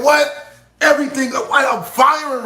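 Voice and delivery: sudden high, loud "What?"; hyped shout. Real, not acted. Rapper hyping his stream chat, excited high shout. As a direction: fire it off at the top of your voice.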